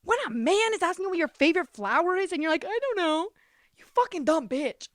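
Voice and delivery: sassy telling-off of her own silly self; scolding herself. Retold or imitated. Berating herself for not knowing her favorite flower, in a sassy scold. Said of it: "I was like".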